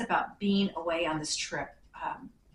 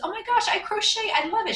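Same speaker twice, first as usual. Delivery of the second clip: much higher and louder, gushing with delight; thrilled squeal; 14 semitones higher, 7 dB louder than usual. Retold or imitated. Waitress gushing over a handmade gift, high excited squeal. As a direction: squeal it like you just got the best present.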